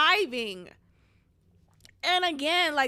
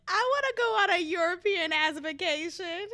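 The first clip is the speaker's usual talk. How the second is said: laughing through a whiny wish; laughing mock whine; 6 semitones higher than usual. To her viewers, laughing at her own wish. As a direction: giggle out the wish as a joke.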